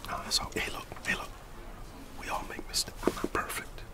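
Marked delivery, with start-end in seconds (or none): none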